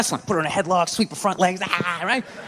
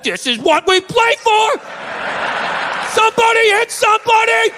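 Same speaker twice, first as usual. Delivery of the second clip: pumped-up shout; 11 semitones higher than usual. Retold or imitated. Hyped player yells at his teammates in a stadium tunnel, full shout. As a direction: roar like a linebacker before kickoff. Dog as fired-up football player.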